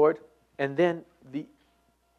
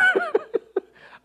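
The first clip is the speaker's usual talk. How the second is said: high burst of laughter; 5 dB louder than usual. Real, not acted. Laughs at own late nights.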